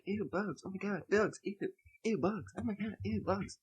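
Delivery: soft, high-pitched mimicry; mocking under the breath. Imitating squeamish moviegoers to himself, mocking under his breath. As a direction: mimic them quietly in a small, high voice. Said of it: "said in the under my breath".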